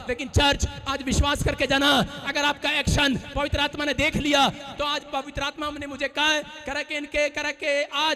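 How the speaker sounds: loud, rising prophetic declaration; preaching at full force. Acted. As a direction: build the prophecy to a shout. (पूरे ज़ोर से प्रचार करते हुए)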